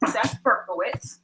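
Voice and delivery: normal talking voice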